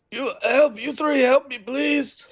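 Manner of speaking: high pleading wounded man; fluttering cries for help. Acted. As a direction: beg the passing riders for help. Dying man begs the party.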